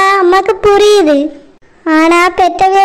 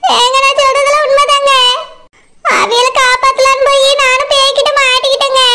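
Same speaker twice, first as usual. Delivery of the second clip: a pleading wife's voice, put on; 6 semitones higher than usual. Wife pleads with disbelieving husband, put-on voice. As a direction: plead your case to a doubting husband.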